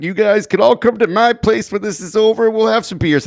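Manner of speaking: put-on drunk trucker voice. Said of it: "that guy was drunk out of his mind".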